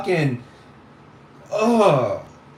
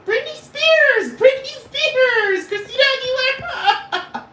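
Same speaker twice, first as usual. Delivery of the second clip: very high, slow, squealing fan voice; breathless fan worship; fangirl squeal; slower than usual. Retold or imitated. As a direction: squeal the names like a starstruck kid. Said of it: "I'd be like".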